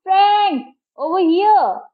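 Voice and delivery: high, loud shout from afar; distant call. A narrator's reading. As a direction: call across the waves to be found. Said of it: "a voice answered me".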